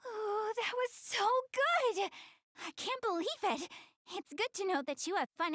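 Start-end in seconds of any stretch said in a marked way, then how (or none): 0.1-3.7 starry-eyed fan squeal; thrilled. Acted. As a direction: savour it like you just finished a great episode. After watching anime, thrilled.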